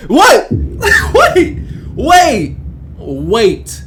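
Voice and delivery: high, loud shocked outburst; laughing disbelief. Real, not acted. Streamer reacting to the retold threat, loud laughing disbelief. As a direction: yelp it through laughter, then come down.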